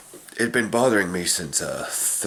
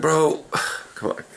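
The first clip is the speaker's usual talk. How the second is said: slow, sighing, fed-up plea; exasperated sigh; 10 semitones higher than usual. Retold or imitated. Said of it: "And I was like".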